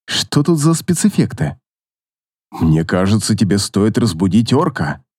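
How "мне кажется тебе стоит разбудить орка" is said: low, uneasy warning; worried companion. A narrator's reading. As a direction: voice a concerned male spirit. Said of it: "с беспокойством заметил Ансельм".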